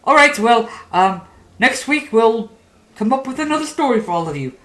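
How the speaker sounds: puppeteer signing off. Acted. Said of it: "looks around and is like".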